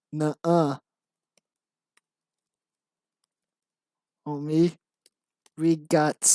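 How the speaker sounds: lightly voiced gangster, word by word; mock gangster with separated words. Writer reading out own script, mock gangster. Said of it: "stereotype of how gangsters talk".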